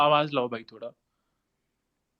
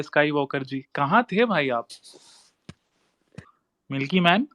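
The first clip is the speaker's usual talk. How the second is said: usual speaking voice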